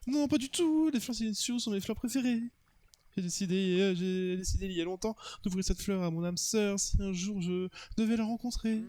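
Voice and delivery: earnest declaration from a smitten admirer; lovestruck suitor. Acted.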